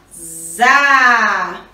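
ZA with a long, held A; teacher sounding out a long "za"; syllable sound demo. Shown as a demonstration. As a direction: buzz the Z, then open into a drawn-out "za". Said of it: "a junção do Z com o A".

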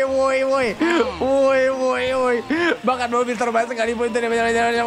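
ordinary talk, nothing marked

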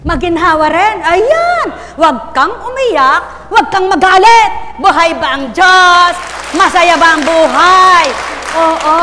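rousing preacher whipping up the congregation; full-force preaching. Real, not acted. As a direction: shout it out to the whole crowd.

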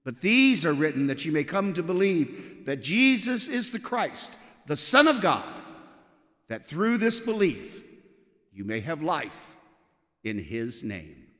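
ordinary talk, nothing marked

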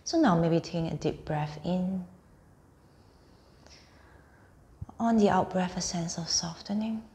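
unhurried meditation voice, phrase by phrase; guided meditation. Acted. Teacher guiding listeners lying on mats through a body scan, guided meditation.

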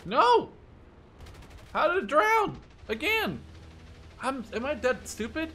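real shock at another miss; disbelieving cry. Real, not acted. Torpedo drowns again.

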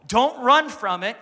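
loud, rising preaching. Real, not acted. Preacher rallies the church against Goliath, loud and rising. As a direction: stride toward the giant with faith. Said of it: "preaching at full force".

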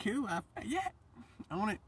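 high-pitched, playful eagerness; lightly acted keen little voice; eager acceptance. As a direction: squeak out an eager yes. Acts out eagerly accepting angels' messages.

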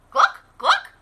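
very high loud clucking; hammed-up hen clucks; chicken cluck. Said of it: "said Chicken".

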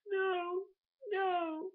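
high-pitched fake sobbing wail; mock crying. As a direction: whimper it like a hammed-up sob. Re-enacts crying at night's end.

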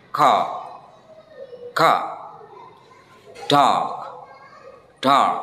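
dark with the r dropped, long ah; teacher demo of non-rhotic Dark; British dark. Shown as a demonstration. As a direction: say Dark as dahk. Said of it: "R-nya dihilangkan menjadi dark".

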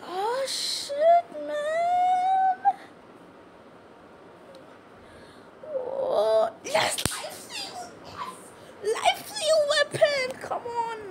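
excited squeal. Real, not acted. Gamer gets rare weapon.

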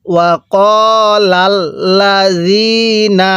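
ওয়াকালাল্লাযীনা very slow, long vowels held; soft-zal recitation. Shown as a demonstration. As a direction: soften the z in ওয়াকালাল্লাযীনা to "dh". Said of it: "জালটা নরম করে".

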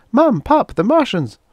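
put-on a girl bursting in with big news; excited teenage girl. A narrator's reading. Daughter rushing in to tell her parents, excited girl voice.

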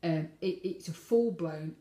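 ordinary talk, nothing marked

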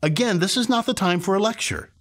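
normal talking voice

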